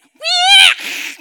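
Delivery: very high shouted pig squeal; shrill pig imitation. Pigs squealing.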